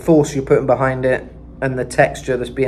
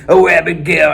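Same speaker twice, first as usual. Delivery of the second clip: gritty rock voice; 3 semitones higher, 7 dB louder than usual. Shown as a demonstration. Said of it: "he's got this real gritty".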